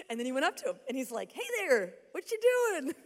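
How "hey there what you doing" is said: mock-casual, higher and louder hello; breezy, higher hey there to a stranger; casual greeting. Glenn greeting the man by the lamppost, mock-casual and higher.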